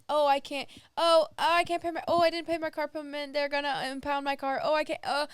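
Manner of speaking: high, mock-panicked spiral; re-enacted panic, tripping over restarts. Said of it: "every time I've been so panicked".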